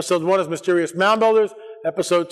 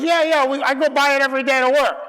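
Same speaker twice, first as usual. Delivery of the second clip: higher-pitched, put-on voice of a local; imitated local host; 9 semitones higher, 3 dB louder than usual. Host answering a visitor about the mound, imitated.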